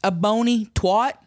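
slow, spelled-out repeat; deliberate, drawn-out words. Acted. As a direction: say it again slowly for someone who missed it. Repeats it after "Beg pardon?.

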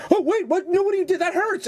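high, squealing imitation of him in pain; mock pained whimper. Mocking his co-host getting hooked, high squealing pain. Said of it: "you going".

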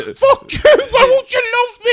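high, loud pleading whine; mock whiny wail. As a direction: whine like a spurned lover. Mock pathetic Irishman.

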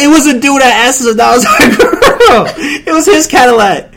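high, loud and breaking into laughter; punchline through real laughter; laughing punchline. Real, not acted. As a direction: tell it while cracking up. Cracks up at story's punchline.

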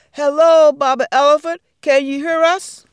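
loud high call up to a giant. Acted. Tiny spiders calling up to Big Baba Elephant. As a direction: project it up to his ear.

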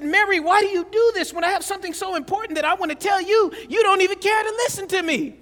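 hammed-up hurt complaint to his wife; upset husband yelling. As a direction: blow up at her for not listening. Complaining wife ignores him.

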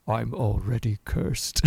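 low, slow, doomed little voice; gloomy cursed character. Retold or imitated. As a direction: sigh it out like he's already doomed. Gloomy cursed character in picture book.

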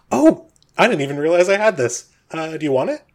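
sudden surprise, then a casual offer; Bob, pleasantly surprised. Acted. Game master plays Bob.

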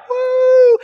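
high loud whoop to lead the crowd; mock crowd woo. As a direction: cheer to egg them on. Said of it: "he's just baiting them".

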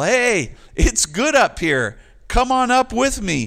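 yelling from the peak. Retold or imitated. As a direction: shout down the mountain, inviting them up. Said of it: "he's yelling at people".

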